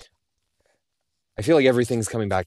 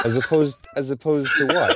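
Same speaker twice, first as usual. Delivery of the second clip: higher, amused through laughter; laughing question; 4 semitones higher, 4 dB louder than usual. Real, not acted. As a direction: ask it while cracking up. Misheard "humid" as "human", amused.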